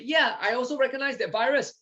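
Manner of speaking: lightly acted cell, piping up in agreement; helper T cell speaking. Another T cell says it recognizes the virus, high-pitched agreement. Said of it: "helper T cell say".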